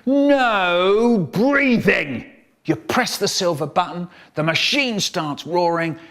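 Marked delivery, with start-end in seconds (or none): 0.0-2.3 very slow, drawn-out stern order; teacher forbidding breathing, very slow and stern; strict teacher. Retold or imitated. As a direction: stretch each word like a strict teacher. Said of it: "She used to stand out the front going".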